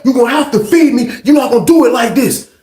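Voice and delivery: high, forceful enraged demands; imitated snapping. Hungry inmate demanding chicken from sergeant, imitated angry shouting. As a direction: hammer out each demand, acting out a man losing it. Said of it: "he's snapping".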